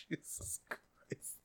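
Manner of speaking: whispered, worn out from laughing; breathless whisper. Real, not acted. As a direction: gasp it out, spent. Exhausted after laughing fit.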